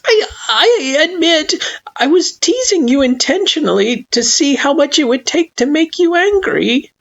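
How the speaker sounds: high, tearful, stumbling admission; nervous, near tears; panicked confession. Acted. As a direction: get it out before he snaps. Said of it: "slightly panicked".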